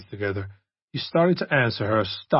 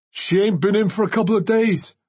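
much higher, louder bartender voice; gruff bartender; 10 semitones higher, 4 dB louder than usual. Acted. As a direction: brush him off. Bartender answers Kent.

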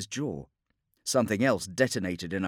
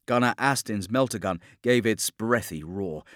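usual speaking voice

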